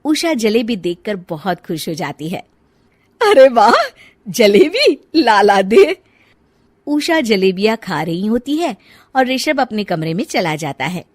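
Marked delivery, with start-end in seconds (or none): 3.2-6.0 loud, high delighted exclaim; gleeful at the sweets. Acted.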